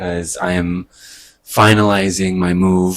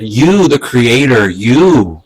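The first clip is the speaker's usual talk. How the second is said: louder, slow, drawn-out YOU; direct address; 3 semitones higher, 5 dB louder, slower than usual. Retold or imitated. Video speaker breaking the fourth wall to the creator, emphatic direct address. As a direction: imitate her addressing the viewer, loud and emphatic. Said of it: "she, like, broke the fourth wall".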